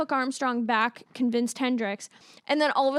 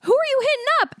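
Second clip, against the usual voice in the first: much higher, louder mock disbelief; incredulous question; 14 semitones higher, 7 dB louder, much faster than usual. Viewer baffled by the next pick, high incredulous squeal. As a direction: squeal it in amazement. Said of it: "I was like".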